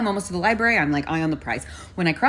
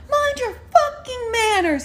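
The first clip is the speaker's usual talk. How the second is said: very high, drawn-out angry yell; retold scream, each word stretched; screaming back; 4 dB louder, slower than usual. Pedestrian yelling at a bus driver, slow high scream. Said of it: "so I'm screaming back".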